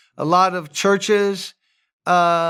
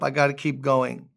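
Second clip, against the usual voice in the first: ordinary talk, nothing marked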